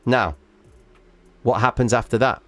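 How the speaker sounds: usual speaking voice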